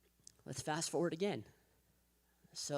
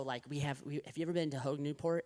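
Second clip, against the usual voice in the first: usual speaking voice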